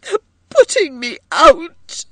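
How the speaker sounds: loud, high, tearful wail. A narrator's reading. Edith collapses against her husband John, wailing. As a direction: wail it out to be comforted. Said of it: "she wailed".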